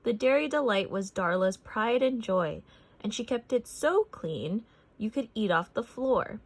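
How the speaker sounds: ordinary delivery, nothing marked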